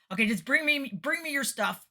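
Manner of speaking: loud, high, punchy questions; brisk take-charge. Retold or imitated. Sound guy asking the singer's needs, loud and brisk. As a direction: fire it out as the no-nonsense tech.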